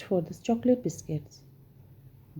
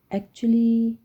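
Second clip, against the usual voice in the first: knowing; 4 semitones higher, 5 dB louder than usual. Acted. Hannah's sly reply.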